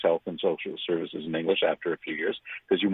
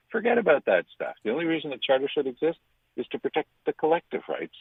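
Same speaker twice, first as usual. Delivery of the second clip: higher, dismissive voice of the language czar; mocking paraphrase; 7 semitones higher than usual. Commentator mocking the language czar, higher and dismissive. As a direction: toss off the rights as nonsense, pitched up.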